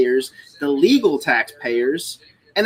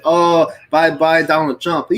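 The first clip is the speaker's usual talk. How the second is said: mocking coworker; 3 dB louder, slower than usual. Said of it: "he was like".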